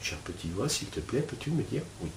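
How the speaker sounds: hushed, gentle asking; soft inner question. Shown as a demonstration. Teacher to students, asking the inner voice: hushed and gentle. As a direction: ask your inner voice softly, almost to yourself. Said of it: "vous réitérez, euh, la, la question".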